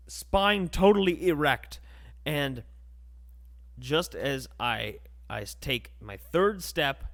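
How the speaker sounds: normal talking voice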